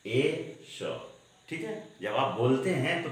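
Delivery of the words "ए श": "ए, श" as two separate sounds, vowel first; letter split into sounds. Shown as a demonstration. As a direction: hold "ए" alone, pause, then release "श". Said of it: "आप ऐसे बोलिएगा".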